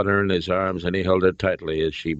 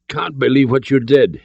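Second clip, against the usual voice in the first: forceful, raised stage whisper; hoarse whisper; 7 semitones higher, 5 dB louder than usual. A narrator's reading. Father to son after the killings, hoarse and aghast. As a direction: push out a hoarse, higher-pitched whisper. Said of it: "Henry whispered hoarsely".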